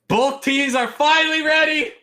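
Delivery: high, loud caster hype; excited announcer. Real, not acted. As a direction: announce it like the match is starting. Caster announces the teams are ready.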